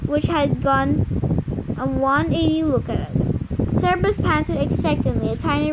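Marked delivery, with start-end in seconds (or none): none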